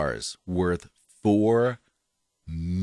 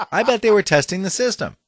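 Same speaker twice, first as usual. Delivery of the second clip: laughing through the words; 10 semitones higher, 4 dB louder than usual. Real, not acted. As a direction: chuckle it out. Laughing while speaking.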